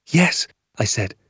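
put-on high, eager child's reply; boy keyed up with anticipation. A narrator's reading. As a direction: answer dad in a high, excited little voice. Excited boy answers his father.

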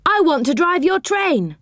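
high, eager calling out; yell. A narrator's reading. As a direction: call your wish over to the driver. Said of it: "yelled Joe to the driver".

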